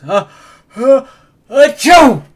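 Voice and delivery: a building uh, uh, then a loud high achoo; big sneeze. Acted. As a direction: wind up and burst into a sneeze. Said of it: "make him sneeze".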